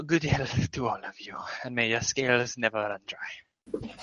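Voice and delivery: a small creature murmuring its thanks; kobold voice. Acted. Morzar's farewell blessing.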